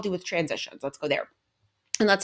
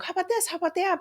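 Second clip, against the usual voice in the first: much higher, eager pitch on "How about this? How about that?"; a parent anxiously offering distractions; frantic bargaining parent; 13 semitones higher, faster than usual. Retold or imitated. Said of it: "That's when you're like".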